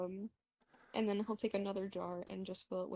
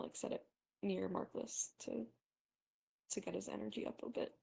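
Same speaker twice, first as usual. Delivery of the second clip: normal talking voice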